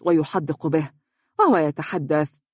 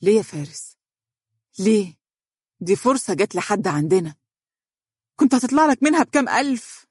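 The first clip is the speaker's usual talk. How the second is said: mildly angry, indignant questioning; 4 semitones higher than usual. Acted. Woman scolding colleague over lost fee, mildly angry. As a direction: build to a higher, exasperated protest. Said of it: "وقالت بغضب".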